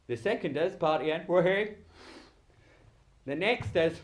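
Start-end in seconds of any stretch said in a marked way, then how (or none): 1.3-1.7 brief, louder mock cheer; party whoop. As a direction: let out a little party cheer. Demonstrates partying scenario.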